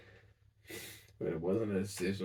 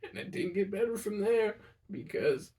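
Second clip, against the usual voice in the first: much higher, tight, close to tears throughout; voice breaking; 9 semitones higher than usual. Real, not acted. Grieving grandson recounting grandpa's aneurysm, voice cracking. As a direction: hold back tears as you tell it.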